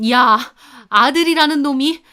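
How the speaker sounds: mother shouting at her son for taking sides; furious scolding. A narrator's reading. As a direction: yell at him in betrayed outrage.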